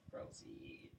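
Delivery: whispered decree. Acted. Chosen One grants permission.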